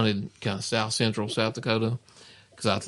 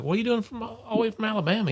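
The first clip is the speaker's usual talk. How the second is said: much higher, fast mock Southern lady; imitating the lady; 10 semitones higher, faster than usual. Gas station lady quizzing the Alabama hunter, high fast mock voice. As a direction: squeak it out like the curious lady.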